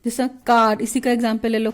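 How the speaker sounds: usual speaking voice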